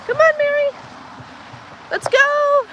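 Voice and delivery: high-pitched coaxing call; high call to hurry the cow along. Real, not acted. Calling the cow in.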